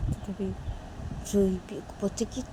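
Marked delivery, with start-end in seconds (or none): none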